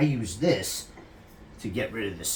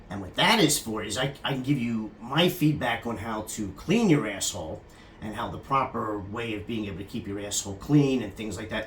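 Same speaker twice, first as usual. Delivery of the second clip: normal talking voice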